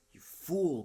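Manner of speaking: exasperated outburst at the slow Duke; cried out. A narrator's reading. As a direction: snap it at him in disbelief. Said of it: "cried Johnson".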